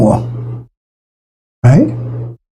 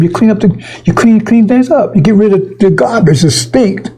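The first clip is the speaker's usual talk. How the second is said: higher, fired-up preaching; preaching at full force; 6 semitones higher than usual. Acted. Preacher rousing the congregation, fired up. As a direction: hammer each word of the list.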